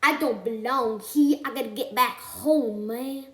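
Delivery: loud mock complaint; hammed-up whine about wanting home; mock homesick movie character. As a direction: imitate an out-of-place urban bear complaining. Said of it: "He's like".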